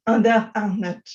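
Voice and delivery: usual speaking voice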